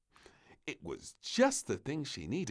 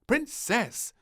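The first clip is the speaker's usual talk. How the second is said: loud, high call across the room; the doctor calls the princess from the doorway; firm call; 12 semitones higher, 8 dB louder than usual. A narrator's reading. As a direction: stop her in her tracks. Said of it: "called a firm voice behind her".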